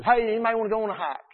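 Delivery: fast, loud, hearty call to the group; rally a bored group, quick and bright; cheery call. Retold or imitated. Youth minister rallying bored kids on camping trip, fast loud call. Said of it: "He said".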